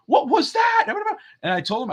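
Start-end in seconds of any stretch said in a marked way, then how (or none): 0.1-0.9 put-on high, loud kid's outburst; a boy annoyed at his teammate, high and loud. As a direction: mimic a boy snapping at his sister. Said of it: "Tristan was like".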